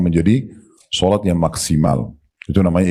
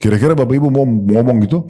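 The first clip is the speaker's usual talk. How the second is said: ordinary delivery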